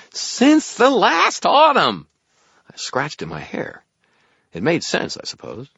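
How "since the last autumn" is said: very high, slow, loud squeal; impatient fairy. A narrator's reading. Fairy whirls impatiently answering wizard, drawn-out squeal. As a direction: stretch it out shrilly, exasperated. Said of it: "whirled around in impatient loops".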